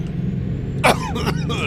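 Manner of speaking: harsh choking cough; hacking cough; coughing fit. Real, not acted. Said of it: "I don't know why I'm choking".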